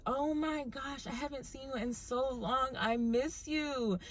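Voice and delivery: high, soft, drawn-out mock gushing; gushing reunion. Waitress greeting Erica after a long time, high soft gushing. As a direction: gush softly up high, stretching the last words.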